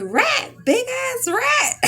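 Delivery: high, loud, slow panicked cry; alarmed shriek. Retold or imitated. Diner at the next table spotting the animal, shrieking in alarm. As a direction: shriek it out like you just saw a rat. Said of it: "she said".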